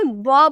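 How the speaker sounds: ब said slow and low as a bare "buh" sound, not the letter name; teacher stresses the sound ब. Shown as a demonstration. Teacher corrects students, slow low sound ब. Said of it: "इसे बी नहीं".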